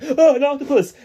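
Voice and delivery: mock wonder, high and bright; mock excited squeal. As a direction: squeal it like a kid spotting an octopus. Quoting excited movie talker.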